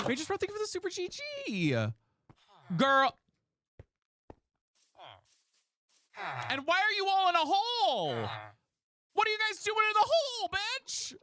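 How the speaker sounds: shrieking way up high in disbelief. Real, not acted. Yelling at friends stuck in a hole, shrieking high. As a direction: screech it at the top of your range.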